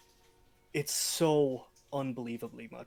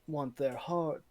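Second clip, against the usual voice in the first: normal talking voice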